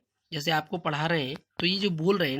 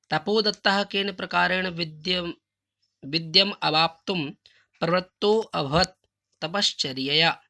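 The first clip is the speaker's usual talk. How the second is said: slow recitation; 2 semitones higher, 5 dB louder, slower than usual. Shown as a demonstration. Teacher recites Sanskrit question.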